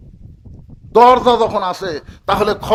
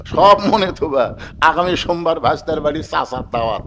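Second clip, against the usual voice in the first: usual speaking voice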